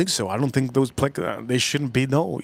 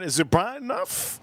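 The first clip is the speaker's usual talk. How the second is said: ordinary talk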